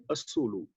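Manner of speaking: ਅਸੂਲੂ with a slight aunkar u sound after the s. Shown as a demonstration. Teacher showing the Gurbani reading ਅਸੂਲੂ with a light aunkar. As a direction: say ਅਸੂਲੂ with a short, light u vowel on the s. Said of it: "ਔਂਕੜ ਦੀ ਆਵਾਜ਼ ਦੇਣੀ ਹੈ".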